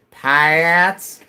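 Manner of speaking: "Pats" stretched to pie-ats, loud and rising. Shown as a demonstration. Streamer guessing at the hidden letters, "Pats" as pie-ats. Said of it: "maybe a Y in there".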